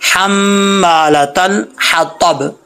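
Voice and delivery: very slow हम्मालतल with a long hummed mm; careful recitation. Shown as a demonstration. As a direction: hold the doubled mm, then crisp हतब. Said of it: "इसको अच्छे तरीके से पढ़ेंगे". (हम्मालतल में गुन्ना, ठहरकर)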